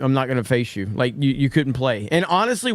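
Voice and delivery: usual speaking voice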